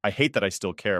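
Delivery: ordinary delivery, nothing marked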